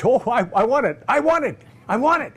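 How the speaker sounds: high, loud excited pleading; a wife who can't wait to have it; eager wanting. Retold or imitated. Wife excitedly demanding the machine.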